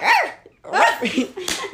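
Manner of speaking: high yelped arf; quick, high little bark; dog bark. Shown as a demonstration. Showing friends a dog bark, high yelp. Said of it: "starts barking".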